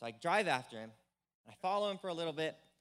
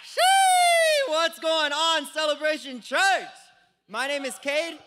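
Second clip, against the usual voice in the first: loud, high hype greeting; excited greeting; 11 semitones higher, 14 dB louder, slower than usual. Real, not acted. Young preacher greeting the church crowd, excited shout. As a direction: burst onto the stage.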